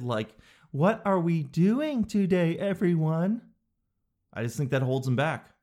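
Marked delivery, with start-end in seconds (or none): 0.7-3.4 put-on slow, raised-pitch talking-to-kids voice; super affected. Adult addressing a group of kids, slow affected voice. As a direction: draw it out with put-on sweetness. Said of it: "that super affected".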